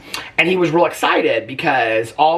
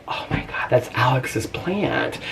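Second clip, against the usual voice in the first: shocked whisper; 5 dB quieter, slower than usual. Retold or imitated. Discovering husband's broken plant.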